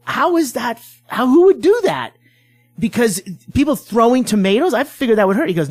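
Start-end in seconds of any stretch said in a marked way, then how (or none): 0.1-2.1 mock disbelief, pitched up; incredulous. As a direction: act baffled at the idea. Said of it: "I go".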